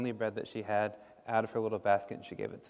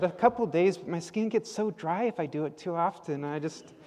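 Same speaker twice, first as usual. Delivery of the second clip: put-on kid voice; 8 semitones higher than usual. A narrator's reading. Sasha voiced in story.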